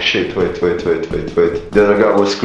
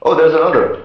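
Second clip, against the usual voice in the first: excited; 8 semitones higher, 4 dB louder than usual. Real, not acted. Spotting monster in game.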